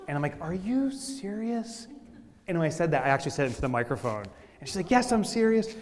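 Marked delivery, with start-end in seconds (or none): 0.4-1.9 slow, higher-pitched disbelief; retold incredulous question. Retorting to flight attendant's boarding announcement. 4.9-5.7 higher, louder insistent comeback; mock-indignant confirmation; indignant retort. Flight attendant retorts over microphone.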